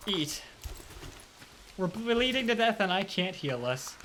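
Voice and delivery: usual speaking voice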